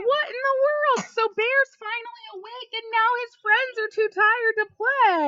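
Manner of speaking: high, bright amazed reading; mock surprise. A narrator's reading.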